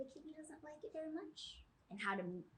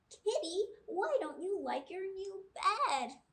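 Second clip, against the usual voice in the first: put-on higher, louder puzzled child; pleading little girl; 9 semitones higher, 6 dB louder than usual. Chloe asks Kitty why she won't use the new bed.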